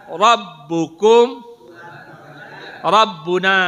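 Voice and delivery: slow, loud, drawn-out words for the class to repeat; slow drill recitation. Shown as a demonstration. Teacher leading the class to read the words together, slow and loud. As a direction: lead the class word by word, slowly and loudly. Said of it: "saya bahasa Arabnya".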